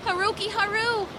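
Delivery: very high desperate cry; calling out. A narrator's reading. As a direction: call his name across the storm. Mermaid calls out.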